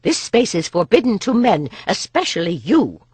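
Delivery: angry guard. Acted. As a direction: threaten him out of the doorway. Nessa drives off a man.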